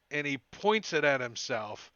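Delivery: usual speaking voice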